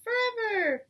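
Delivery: high, loud joyful cry; delighted cry. A narrator's reading. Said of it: "they cried".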